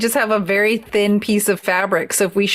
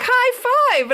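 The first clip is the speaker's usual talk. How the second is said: excited. Retold or imitated. Boyfriend's cheerful reply retold.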